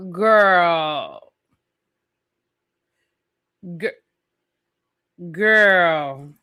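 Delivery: put-on low, stretched girl, full of side-eye; drawn-out scolding girl. Host calling out a cast member, low and drawn out. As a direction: call her out with a long low girl.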